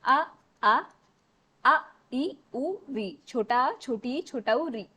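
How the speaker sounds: each short vowel "अ, आ" said alone, loud and crisp; short vowels demo. Shown as a demonstration. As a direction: call out each short vowel sound distinctly, teacher style. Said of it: "हस्त स्वर हमारे चार प्रकार".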